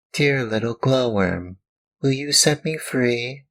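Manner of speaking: high, pleading little puppet voice; pleading puppet boy. Acted. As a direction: beg for help like a small wooden boy. Puppet begs the glowworm.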